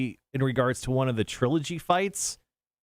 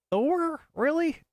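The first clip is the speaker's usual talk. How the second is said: surprised; much slower than usual. Real, not acted. Surprised at Thor option.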